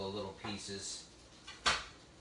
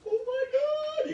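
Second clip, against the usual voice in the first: much higher, louder, drawn-out squeal; squealing delight; 11 dB louder, slower than usual. Real, not acted. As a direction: squeal at the sight of the dish. Unveiling the finished dish.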